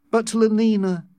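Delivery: high-pitched protest. A narrator's reading. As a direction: object with a pleading push. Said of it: "John protested".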